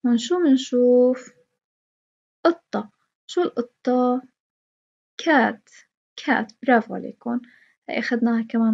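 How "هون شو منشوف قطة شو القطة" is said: ordinary delivery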